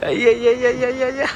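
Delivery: high, drawn-out string of cries; playful exclamation. Real, not acted. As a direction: let the syllables ripple out high and long. Exclaiming at the airport teddy display.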